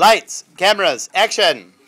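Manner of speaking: loud announcer call; director call. Real, not acted.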